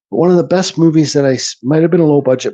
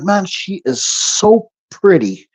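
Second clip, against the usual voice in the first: slow, tender murmur of admiration; tender, slow awe; 4 semitones higher, much slower than usual. Retold or imitated. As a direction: linger on each word, touched. Moved by pretty movie girl.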